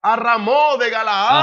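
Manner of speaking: loud, slow, rising question; high, drawn-out proclamation ending upward; acted royal question. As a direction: stretch the place name out to the prophets. Acted king questioning the prophets.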